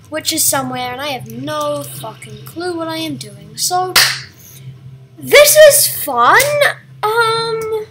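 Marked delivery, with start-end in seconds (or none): 5.2-6.4 drawn-out pained cry; loud mock anguish; anguished wail. Real, not acted. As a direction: wail it out slowly in despair. Sarcastic cry while playing.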